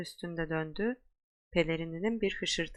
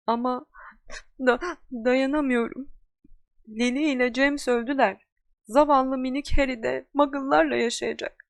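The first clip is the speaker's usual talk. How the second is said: crying; 6 semitones higher, 7 dB louder than usual. Acted. Hagrid grieves for Lily and James, high loud crying. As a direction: blubber it out like a heartbroken giant. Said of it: "yaralı bir köpek gibi ulumaya başladı".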